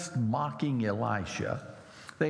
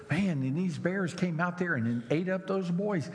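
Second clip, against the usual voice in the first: teacher's question; 7 semitones higher than usual. Retold or imitated. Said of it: "Bible class teacher who was telling the story".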